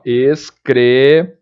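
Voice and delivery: "excremen-" lento, sílaba por sílaba; transcrição fonética silabada. Shown as a demonstration. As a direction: estique o "men" final de "excremen-". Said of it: "ficaria assim, ó". (diga "excremen-" devagar, como quem escreve cada som)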